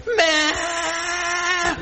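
put-on high, strained toddler wail for mom; mock child's wail; imitating a toddler's cry. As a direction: cry out like a small child wanting her mother. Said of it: "and started crying".

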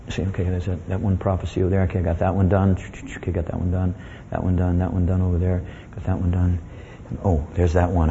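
low, soft murmur to himself; quiet checklist. Acted. Jesus on the cross checking off prophecies, quiet murmur. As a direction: tick them off under your breath in a low voice.

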